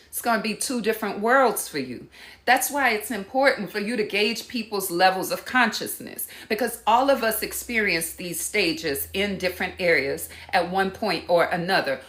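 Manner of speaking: ordinary talk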